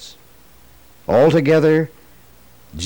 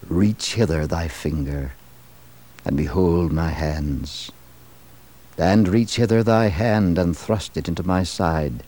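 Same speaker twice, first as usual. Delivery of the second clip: voice of Jesus; 6 semitones lower than usual. A narrator's reading. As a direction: speak as a gentle, solemn Jesus. Jesus speaks to Thomas.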